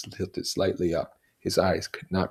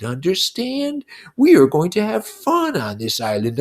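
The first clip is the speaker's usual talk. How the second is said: much higher, loud, slow scolding schoolmaster; stern schoolmaster; 9 dB louder, slower than usual. Acted. Lord of the Flies lecturing Simon as a schoolmaster, menacing. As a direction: scold like a cruel headmaster. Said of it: "spoke in the voice of a schoolmaster".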